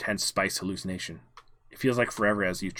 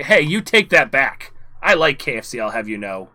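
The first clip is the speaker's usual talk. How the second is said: indignant protest; 3 semitones higher, 8 dB louder than usual. Acted. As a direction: defend KFC loudly. Character protests angrily.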